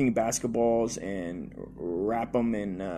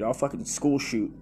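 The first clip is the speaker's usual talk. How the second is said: ordinary talk, nothing marked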